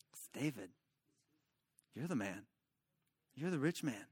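very soft, low, with long pauses; hushed, grave acted verdict; quiet confrontation. Nathan quietly confronts David with truth.

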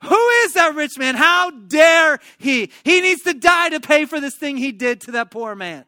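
high, loud, indignant shouting; acted outrage, stretching the words in fury; angry outburst. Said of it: "He's angry. He's like".

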